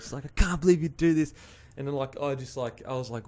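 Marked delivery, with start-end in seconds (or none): 0.2-1.3 higher-pitched mock wail, no real sobbing; retold tearful reproach; imitating bawling ex. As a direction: mimic an upset ex, pitched up. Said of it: "she's, like, bawling her eyes out".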